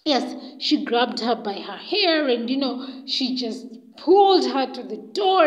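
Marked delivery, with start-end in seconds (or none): none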